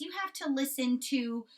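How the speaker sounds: ordinary delivery, nothing marked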